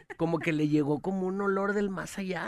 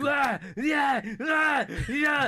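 mock screaming lover; 6 semitones higher, much slower than usual. Friend mocking the woman yelling in the motel, hammed-up high yelps. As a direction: yell like she's going wild in bed. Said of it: "era un gritadero".

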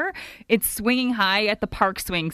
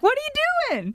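mock disbelief. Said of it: "I'm like".

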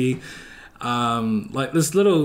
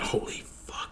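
hushed, breath-only disbelief; awed whisper; 13 dB quieter than usual. Retold or imitated. Retelling seeing a famous streamer drive past, starstruck whisper.

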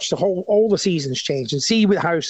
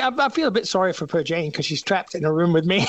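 ordinary talk

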